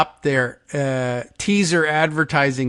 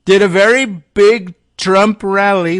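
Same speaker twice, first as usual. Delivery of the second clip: slow, loud, higher mock boast; all-caps shout; 6 semitones higher, 7 dB louder than usual. Host reading Trump's post, loud mock boast. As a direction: belt it like a rally. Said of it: "both capitalized".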